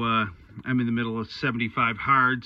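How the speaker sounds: normal talking voice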